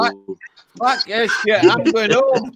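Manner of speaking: high, loud exasperated quit; huffy shout. Retold or imitated. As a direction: yell it like you're storming out. Acting a fleeing character.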